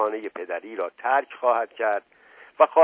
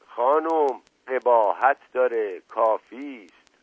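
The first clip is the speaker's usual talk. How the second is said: usual speaking voice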